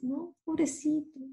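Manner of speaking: usual speaking voice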